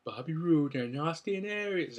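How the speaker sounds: ring announcer. Retold or imitated. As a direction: announce the names to the crowd. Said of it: "And then she goes".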